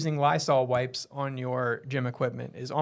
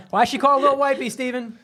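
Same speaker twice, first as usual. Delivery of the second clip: loud, high-pitched playful question; teasing repeat; 9 semitones higher, 7 dB louder than usual. Real, not acted. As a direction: ask it high and loud through the fun. Repeats the question amid laughter.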